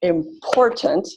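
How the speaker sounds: "Important" slowly, stress on the second syllable; clapped stress on "Important". Shown as a demonstration. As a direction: clap out "Important" beat by beat. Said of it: "I clap it out".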